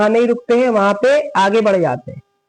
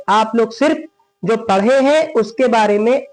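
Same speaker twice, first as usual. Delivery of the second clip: ordinary talk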